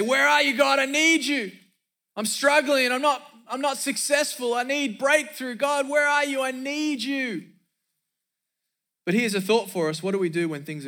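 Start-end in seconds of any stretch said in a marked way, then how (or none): 0.0-7.5 high, pleading cry to God. Acted. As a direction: plead desperately to God in a raised, acted cry. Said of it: "we always cry out to God".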